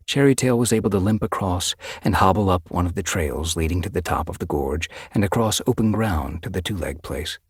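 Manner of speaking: ordinary delivery, nothing marked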